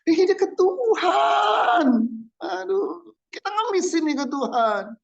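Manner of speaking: a preacher mocking beggars at God, wailing high. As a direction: wail like someone begging God. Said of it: "mocking begging prayer".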